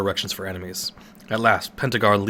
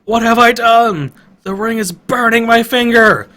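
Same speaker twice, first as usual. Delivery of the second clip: horrified screech; 12 semitones higher, 11 dB louder than usual. Acted. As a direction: scream in pain and terror. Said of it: "Lydia screeches".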